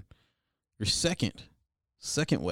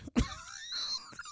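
high laugh; 9 dB quieter than usual. Real, not acted. Laughing at own joke.

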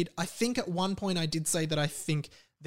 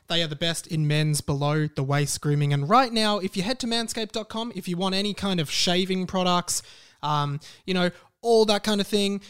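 louder, upbeat sponsor pitch; punchy louder ad read with pep; 2 semitones higher, 4 dB louder than usual. Acted. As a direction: sell it like a podcast ad.

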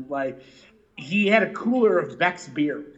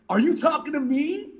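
raised mock-tough challenge, higher pitch; 8 semitones higher than usual. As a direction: throw the line back like a mock threat. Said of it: "Did he just quote Pantera at me?".

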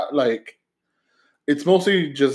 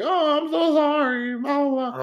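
put-on high, slow, sorry old lady voice; mock apologetic lady; 12 semitones higher, slower than usual. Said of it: "She's like".

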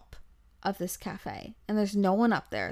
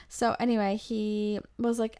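ordinary talk

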